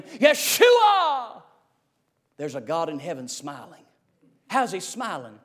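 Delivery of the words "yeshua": Yeshua shouted high and loud in scorn; a jeering mob yelling Yeshua at fleeing David; mocking yell. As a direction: hurl the name like a taunting crowd. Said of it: "as they yell at him".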